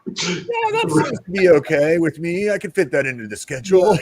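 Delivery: high, playful fake nonchalance; mock casual. Telling friends he coolly agreed, high mock casual. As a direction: pretend it's no big deal, voice up.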